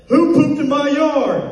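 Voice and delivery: high, loud, slow yell of who pooped; angry neighbor shout. Retold or imitated. Neighbor yelling at the street about his yard, slow high shout. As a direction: bellow it out the door, high and slow. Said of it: "He said".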